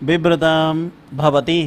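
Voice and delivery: slow, measured Sanskrit chanting with long pauses; unhurried scripture recitation in class; slow shloka recitation. Shown as a demonstration. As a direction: recite the verse word by word like a teacher. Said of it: "मैं तुम्हें श्लोक के माध्यम से समझाता हूं".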